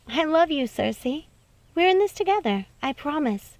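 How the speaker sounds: a hint of a sweet young cousin pleading; Snow reassuring.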